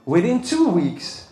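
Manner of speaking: ordinary talk, nothing marked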